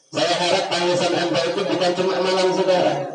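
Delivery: high, loud, fervent preaching prayer; full-force prayer. Acted. Ustaz leading the children in a tearful plea to Allah, in high loud fervent prayer. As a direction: cry out to God with the whole congregation.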